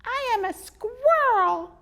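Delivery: high, slow, loud squeaky squirrel. Acted. Squirrel correcting the boy, slow and squeaky. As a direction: insist on it, squeaky and firm.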